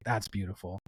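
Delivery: soft, awed murmur; quietly amazed; hushed awe. Retold or imitated. Awed reaction.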